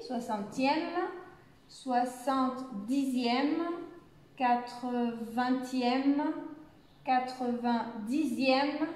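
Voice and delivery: slow, syllable by syllable; slow careful pronunciation. Shown as a demonstration. Teacher drills long ordinals slowly for beginners, syllable by syllable. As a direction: spell the sounds out slowly for learners. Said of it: "slow model pronunciation of ordinals".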